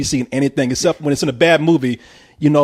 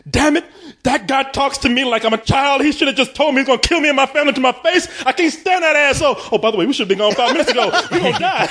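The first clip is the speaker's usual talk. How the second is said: much higher hammed-up panicked father; mocking the father; 9 semitones higher than usual. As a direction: rant like a frantic dad stuck in the car. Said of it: "he's like".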